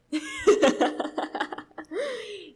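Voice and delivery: long, high-pitched burst of giggles; real high laugh. Real, not acted. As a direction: crack up at your own story. Laughs at her own story.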